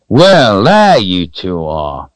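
loud, drawn-out hail starting high; stranger's hearty call, falling away at the end. A narrator's reading. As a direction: call out to people down the path. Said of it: "a stranger's voice called out".

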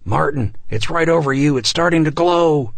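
loud, high urgent warning; alarmed warning. A narrator's reading. Neighbor warning Martin from the church, alarmed shout. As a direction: warn him of the danger above.

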